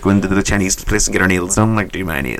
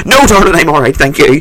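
high, louder polite refusal; flustered refusal; 7 semitones higher, 5 dB louder than usual. Retold or imitated. Refusing a masseuse's offer, high flustered politeness. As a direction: politely turn her down, flustered.

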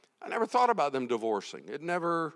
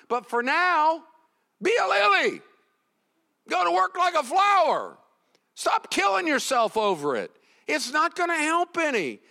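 high, forceful exhortation; full-force preaching; 11 semitones higher, 5 dB louder than usual. Real, not acted. Preacher urging workers to be like a lily, impassioned. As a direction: drive each command home in a raised voice.

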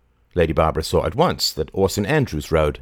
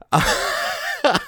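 breathy laugh. A narrator's reading. Mary laughing.

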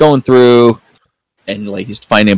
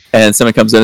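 normal talking voice

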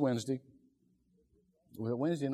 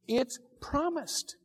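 slow, emphatic; 9 semitones higher, slower than usual. Real, not acted.

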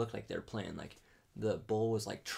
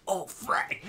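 startled yelp; 9 semitones higher, 6 dB louder than usual. Retold or imitated. Muzzle blast in his face.

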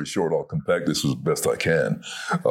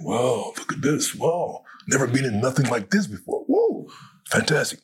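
awed; 11 semitones higher than usual. Retold or imitated. Awed inner thought in studio.

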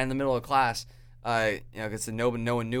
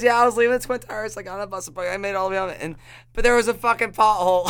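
laughing retelling; 9 semitones higher, 6 dB louder, faster than usual. Retold or imitated. Retelling mom's story, laughing.

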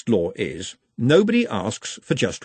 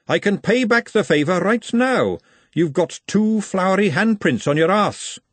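higher, calling-out voice; raised voice; 9 semitones higher than usual. A narrator's reading. Glenda calls after the scurrying maid, raised voice. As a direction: call it pointedly after someone leaving. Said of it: "But she raised it again to add".

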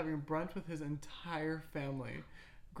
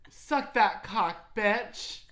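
mock dominant; 6 semitones higher, 11 dB louder, much slower than usual. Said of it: "Could you imagine".

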